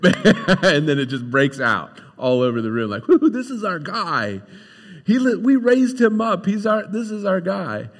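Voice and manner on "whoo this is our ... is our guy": higher, louder whooping hometown crowd; excited crowd cheer. Retold or imitated. Townsfolk cheering their hometown boy, higher and louder. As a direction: cheer him on as one of your own.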